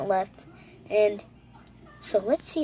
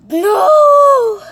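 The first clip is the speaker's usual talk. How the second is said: very high, very loud scream; frustrated scream; 18 dB louder than usual. Real, not acted. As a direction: shriek no in frustration. Dies again, screaming.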